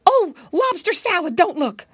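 high, loud pleading exclamation; piggy boy panicking in a shout; frantic cry. Acted. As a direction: beg her urgently not to look. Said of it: "when Curly Tail cried".

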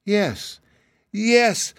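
very high, rapturous agreement; breaking into enchanted delight; rising from wonder to ecstasy; ecstatic. A narrator's reading. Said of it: "clasping her hands in ecstasy".

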